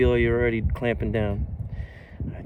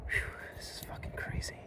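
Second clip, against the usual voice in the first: breathless whisper; 14 dB quieter, faster than usual. Real, not acted. Exhausted relief after cutting rope.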